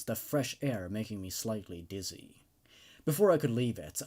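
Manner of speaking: plain reading voice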